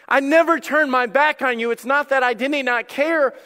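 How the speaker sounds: high, loud preaching as Jesus; impassioned assurance. Acted. Voices Jesus reassuring, forcefully.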